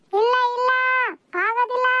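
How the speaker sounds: high-pitched, emphatic refusal; yelled refusal. Acted. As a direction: call it out high for a hard-of-hearing customer. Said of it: "ಅದ್ಯಾಕಂಗ್ ಕಿರ್ಚ್ಕಂಡಿಯೇ".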